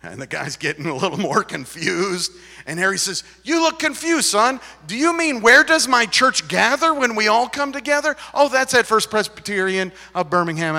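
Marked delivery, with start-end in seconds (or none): 3.4-10.8 high, loud mock clarification; playfully exaggerated helpfulness; teasing retold pastor. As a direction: explain it to a confused lad with comic emphasis. Acts pastor teasing the confused man.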